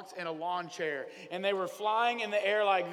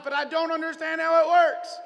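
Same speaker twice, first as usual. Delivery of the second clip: much higher, loud outburst; exasperated shout; 8 semitones higher, 12 dB louder than usual. Real, not acted. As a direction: throw up your hands and shout it. Preacher exclaims about Wi-Fi.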